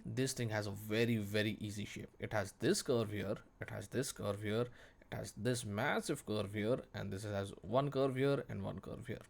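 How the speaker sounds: normal talking voice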